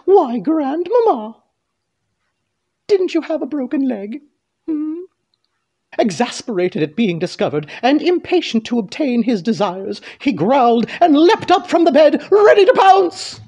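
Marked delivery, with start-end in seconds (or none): 0.0-5.0 put-on high girl voice, pausing before the question; suspicious Little Red. Little Red quizzes grandmother. 10.2-13.4 rising to high, loud narration; dramatic build. A narrator's reading. Narration builds to wolf's attack.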